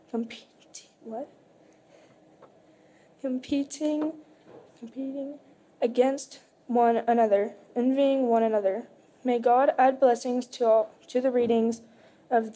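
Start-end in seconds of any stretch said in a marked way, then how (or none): none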